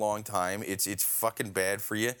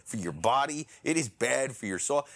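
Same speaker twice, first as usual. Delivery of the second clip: ordinary talk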